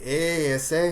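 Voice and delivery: cartoonish Mexican-accented greeting; mock Mexican accent. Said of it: "if it's a Garcia".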